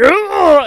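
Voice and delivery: loud, high howl; drawn-out hammed-up "oh"; mock moan. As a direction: wail it like the trucker. Imitates trucker with mouthful.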